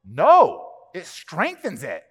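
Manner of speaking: loud punched no, then slow; emphatic retort. Retold or imitated. Preacher answering people warning him, emphatic no. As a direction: shut the worry down firmly.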